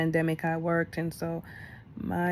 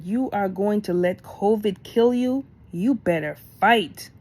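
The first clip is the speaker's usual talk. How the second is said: cussing him out; 3 semitones higher, 5 dB louder than usual. Retold or imitated. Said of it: "I cussed him out".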